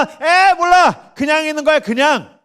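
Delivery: high, loud mock dismissal; dismissive brush-off. Preacher mimics the world ignoring God, dismissive brush-off.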